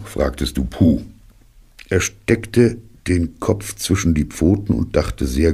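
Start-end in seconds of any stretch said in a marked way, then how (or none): none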